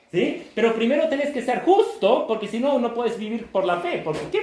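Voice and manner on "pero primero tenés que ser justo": ordinary talk, nothing marked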